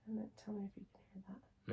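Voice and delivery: very soft breathy whisper. Shown as a demonstration. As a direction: whisper close to the ear. Whisper hearing test on other ear.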